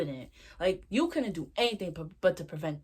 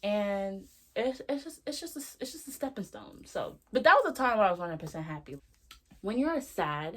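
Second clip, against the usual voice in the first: ordinary talk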